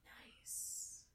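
breathy soft nod of approval; whispered respect. Acted. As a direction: whisper it like a fellow stoner. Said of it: "he's like".

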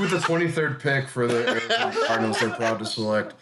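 draft announcer. Pretend. Parodies draft pick announcement.

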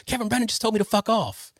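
high-pitched, gleeful mock announcement; excited report to wife. Husband running up to tell his wife, excitedly retold. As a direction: blurt it out high, like big news. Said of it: "run up and tell my wife".

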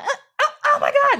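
high, loud cry of sudden pain; mock scream. Granddad suddenly bitten by a snake, hammed-up scream. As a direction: yelp out in exaggerated shock. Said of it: "he starts screaming".

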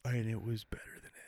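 whispered sly aside; conspiratorial whisper, stirring the pot; teasing whisper. Real, not acted. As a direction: whisper it like a secret jab. Teasing aside about friend's wife.

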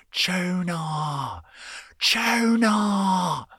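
"Tuna" called very high and drawn out, borderline Australian; mock dog-owner call with a strong near-Australian accent, "Tuna" drawn long and high; imitating Australian-ish call. Teen girl calling her dog back, imitated with a borderline Australian accent. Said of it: "with her accent ... shout it like".

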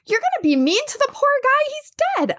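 very high-pitched imitation of a reproachful godmother; scandalized godmother. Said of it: "my godmother walks by ... she goes".

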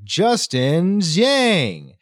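drawn-out mock arena announcement; slow, ringing imitation of an announcer; big-screen announcer. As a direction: stretch the name out, rising high then falling.

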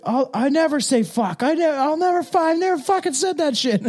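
high, shouty comedy-actor impression; Will Ferrell impression. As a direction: blurt the denial like Will Ferrell. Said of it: "and he was like".

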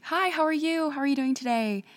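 high, bright-voiced friendly hello; chirpy American greeting. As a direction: sing it out like a cheerful cashier. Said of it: "we'll go like".

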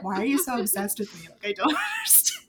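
fast, laughing through a mock bewildered question; laughing Henry. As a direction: quote Henry while cracking up. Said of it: "And Henry's like".

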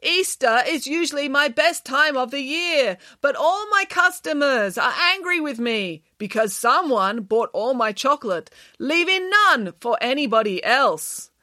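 high, slow, overdramatic complaint; bad-acting lament. A narrator's reading. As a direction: lament like a ham on stage. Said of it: "was not a very good actor".

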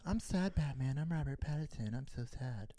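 mopey Batman mock. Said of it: "I hated that Batman".

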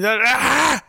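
high-pitched imitated outburst; mock freak-out yell. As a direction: yelp as if losing it, half in jest. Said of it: "He started freaking out".

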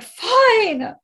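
high, loud tearful wail; mock crying. Daughter giving in to bossy dad, imitated crying. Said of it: "like, crying".

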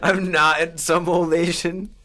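slow, laughing through the words; giggling mock quote; laughing repeat. Real, not acted. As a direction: crack up mid-line.

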